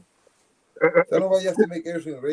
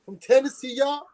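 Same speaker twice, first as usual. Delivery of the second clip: higher-pitched mock American accent; American accent impression; 8 semitones higher, 3 dB quieter than usual. Man threatening to fly across the Atlantic, mock American accent. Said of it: "That was my American accent impression".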